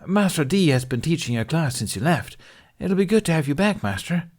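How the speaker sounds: put-on high, quick and keen young voice; a boy glad his teacher is back; eager young padawan. A narrator's reading. Eager child voice of Garen.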